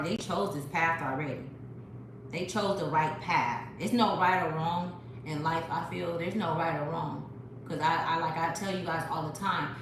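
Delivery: usual speaking voice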